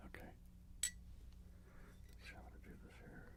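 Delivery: soft unvoiced whisper, close to the mic; ASMR whisper. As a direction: breathe the words gently for the listener.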